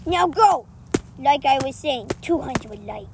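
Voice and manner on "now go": loud, fast command; stern shout. Acted. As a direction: bark the order out. Judge ordering someone out.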